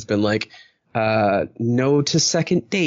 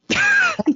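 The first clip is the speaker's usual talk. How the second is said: high laugh. Real, not acted. Laughs after self-deprecating point.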